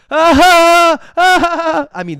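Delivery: high, loud hooting laugh; imitating their mocking laugh; ugly mock laugh. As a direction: let out a weird, ugly cackle. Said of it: "their laughs were ugly".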